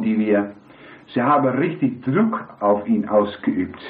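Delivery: normal talking voice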